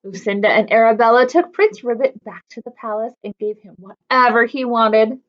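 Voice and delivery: ordinary delivery, nothing marked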